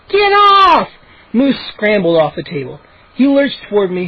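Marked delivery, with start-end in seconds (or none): 0.1-0.9 loud, very high order; shriek. A narrator's reading. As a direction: shriek a desperate command. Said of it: "Mindy shrieked".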